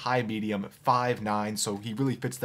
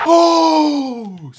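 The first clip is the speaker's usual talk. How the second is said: loud, high triumphant cry; shout of relief; 13 semitones higher, 14 dB louder than usual. Real, not acted. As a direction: let out a huge yell. Goal scored, gamer celebrates.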